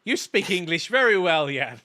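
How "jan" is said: ordinary talk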